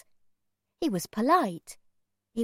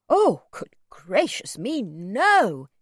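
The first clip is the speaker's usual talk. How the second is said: put-on flustered, louder old man; shocked cry; 7 dB louder, slower than usual. A narrator's reading. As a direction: cry out, slow and appalled. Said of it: "he cried".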